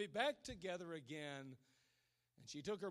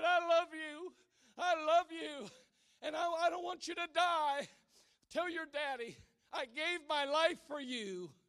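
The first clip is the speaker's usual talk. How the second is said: put-on farewell to child; 9 semitones higher, 10 dB louder than usual. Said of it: "And she said".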